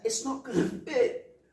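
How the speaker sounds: high, loud, drawn-out comic wail; panicked warning. Retold or imitated. Acting the passenger warning Riggs, panicked. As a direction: stretch it out in mock panic. Acted Murtaugh warning the driver.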